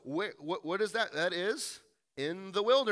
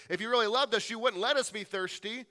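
louder, higher guilt-tripping plea; manipulative plea; 5 semitones higher, 4 dB louder than usual. Acted. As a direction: twist the knife with the plea. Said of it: "it is a manipulation".

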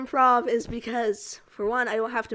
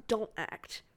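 ordinary talk, nothing marked

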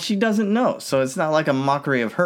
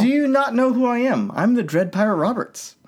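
mock indignant; 8 semitones higher than usual. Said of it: "voicing Wesley".

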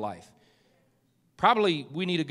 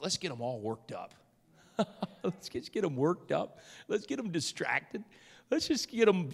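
quiet, sly aside as the enemy; scheming enemy; 5 dB quieter than usual. Acted. Preacher voices the enemy plotting distraction, quiet scheming. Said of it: "I think the enemy just like".

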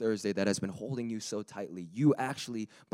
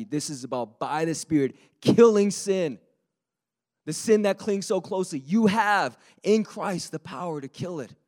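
rising, much louder and higher preaching; building to a shout; 8 semitones higher, 10 dB louder than usual. Real, not acted. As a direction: build it up to a full preacher's shout on killing sin. Sermon climax builds to shout.

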